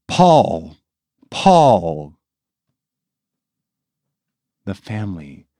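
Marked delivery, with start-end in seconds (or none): 0.1-0.8 calling the name out loudly, high; loud cry. A narrator's reading. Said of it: "cried out in a loud voice". 1.3-2.2 calling the name loudly with a falling tune; loud cry, falling. A narrator's reading. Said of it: "cried out in a loud voice".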